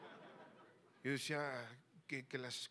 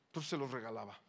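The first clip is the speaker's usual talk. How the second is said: usual speaking voice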